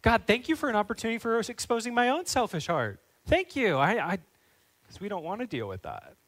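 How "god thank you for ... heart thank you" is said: high, sarcastic thank-you prayer; mock prayer. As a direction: pray it in mock gratitude, pitched up. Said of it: "how often are we just like".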